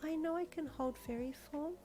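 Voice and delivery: put-on quiet, thoughtful girl; soft girl voice. Heroine thinks to herself.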